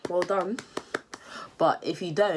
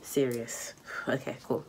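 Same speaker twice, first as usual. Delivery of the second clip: mock serious; 5 semitones lower, 6 dB quieter than usual. Real, not acted. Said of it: "This is a serious video".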